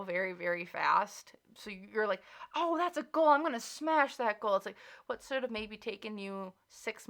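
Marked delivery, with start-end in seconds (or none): 2.5-4.6 higher, louder mock excitement; gung-ho, raised-pitch enthusiasm; eager overachiever. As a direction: jump on it like a keen go-getter.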